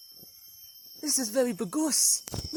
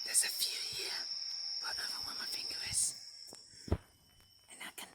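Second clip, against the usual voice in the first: hushed whisper so the rare birds stay put; soft nature-watcher whisper, creeping close to shy birds; 6 semitones lower, 8 dB quieter than usual. Real, not acted. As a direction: breathe the words without voice, barely audible. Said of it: "I'm being very quiet".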